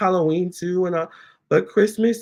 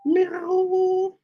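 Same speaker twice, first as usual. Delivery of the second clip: meow imitation. Meowing at her cats.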